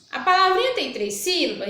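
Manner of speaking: usual speaking voice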